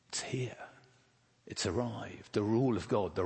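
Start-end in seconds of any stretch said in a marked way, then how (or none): 0.1-0.6 whispered, almost secret; hushed whisper. Acted. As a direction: drop to a whisper to draw them in. Preacher hushes key claim.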